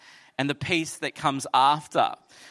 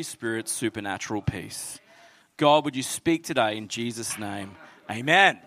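prayer; 3 semitones lower than usual. Acted. Pastor praying for troubled hearts, hushed.

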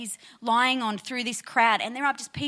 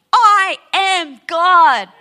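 much louder and very slow declaration; bold proclamation; 4 semitones higher, 11 dB louder, much slower than usual. Acted. Pastor voicing Jesus' claim to the congregation, loud and slow. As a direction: declare it with full force.

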